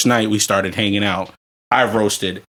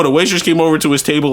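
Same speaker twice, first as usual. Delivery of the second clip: ordinary talk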